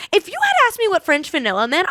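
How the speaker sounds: ordinary delivery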